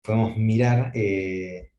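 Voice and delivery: normal talking voice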